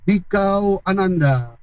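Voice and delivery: slow, drawn-out courtly theatre line; high literary speech. Shown as a demonstration. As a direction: declaim like royalty in old theatre. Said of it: "Ngomongnya sastra tinggi". (gaya sastra tinggi lenong denes)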